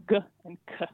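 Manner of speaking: "g and k" said as short sounds "guh" and "kuh"; letter sounds. Shown as a demonstration. Linguist listing merged sound pairs, "g and k" voiced as "guh" and "kuh". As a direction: sound out "g and k", not the letter names. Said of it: "between the sounds".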